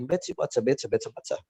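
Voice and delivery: British tongue-twister with crisp, hissy T's; British accent, T's almost TS. Shown as a demonstration. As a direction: sharpen every T, British style. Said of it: "Kalau orang British bilang".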